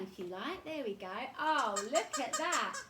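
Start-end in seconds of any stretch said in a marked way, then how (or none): 1.4-2.8 laughing delight. Real, not acted. Delighted at two penguins.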